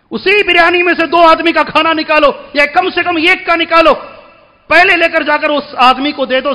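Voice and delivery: forceful preaching. Real, not acted. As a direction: drive the point home from the pulpit.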